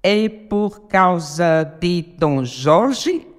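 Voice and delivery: very slow, syllable by syllable; contando sílabas. Shown as a demonstration. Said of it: "a gente conta até o Jor". (escandindo devagar, sílaba por sílaba)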